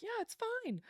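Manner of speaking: forced cheer. Retold or imitated. Pretending he is fine.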